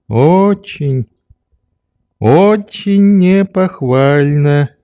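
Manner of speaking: put-on high, drawn-out old man; disapproving old doctor. A narrator's reading. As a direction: tut slowly, stretching the words. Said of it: "Доктор методически покачал головою".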